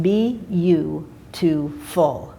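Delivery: slow spelled out. Shown as a demonstration. Spelling out the acrostic.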